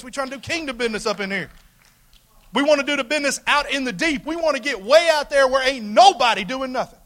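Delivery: rhythmic preacher cadence; Sunday sermon build; preaching. Acted.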